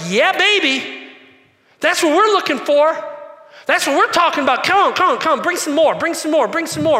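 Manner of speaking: high, loud, hyped-up cheering; cheering crowd. Retold or imitated. Preacher voicing the crowd as they cheer for freedom, hyped and loud. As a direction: whoop it up like a crowd that smells victory. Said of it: "And they're like".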